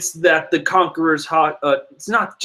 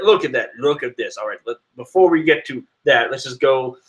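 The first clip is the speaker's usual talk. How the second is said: ordinary talk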